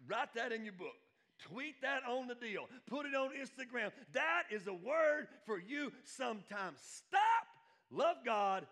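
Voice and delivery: loud, high, drawn-out exhortation; full-force preaching. Real, not acted. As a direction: hammer out each command to the congregation.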